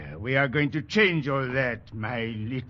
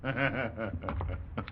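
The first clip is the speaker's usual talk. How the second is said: soft, low contemptuous laugh; cruel chuckle; 4 semitones lower, 9 dB quieter than usual. Acted. Sergeant chuckling scornfully at the recruits. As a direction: laugh at them under his breath.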